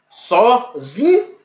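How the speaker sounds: sozinho, so-ZI-nho drawn out slowly with a rising ZI; syllables sounded out. Shown as a demonstration. Teacher drawing out sozinho slowly so the class hears the stressed syllable. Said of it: "Então veja".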